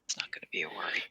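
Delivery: whispered, conspiratorial aside. Real, not acted. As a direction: breathe it low as a secret. Aside about the equipment.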